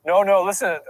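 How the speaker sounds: usual speaking voice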